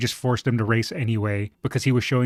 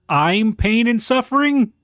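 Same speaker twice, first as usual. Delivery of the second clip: high, loud incredulous cry; shocked realization; 11 semitones higher, 6 dB louder than usual. Real, not acted. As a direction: blurt it out in disbelief. Realizing name means suffering.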